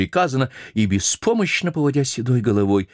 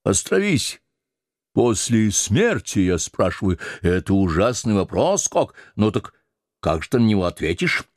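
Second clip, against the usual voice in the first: bossy sailor. Acted. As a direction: lord it over the old cook. Stubb stops and questions the cook.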